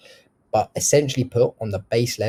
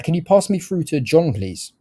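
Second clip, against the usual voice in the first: authoritative request, "please" dropping low; firm ask to a gatekeeper, falling at the end; lowered tone at end; 7 semitones higher, 4 dB louder than usual. Shown as a demonstration. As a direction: end the question on a falling tone like a statement. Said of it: "you wanna lower your tone".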